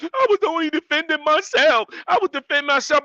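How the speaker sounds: high, loud mock-whiny self-defense plea; hammed-up high mock whine of a YouTube fighter; mocking excuse. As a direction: parody someone crying that they only defended themselves. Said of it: "I don't care if you sit up there talking about".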